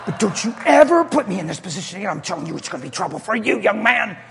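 mother warning her son in the store, loud stern scold. Acted. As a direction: scold the kid hard.

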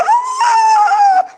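a sudden, very high scream; shocked scream. Retold or imitated. The woman at the window shrieks, very high and loud. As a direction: let out a piercing shriek of amazement.

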